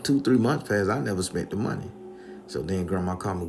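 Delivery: ordinary talk, nothing marked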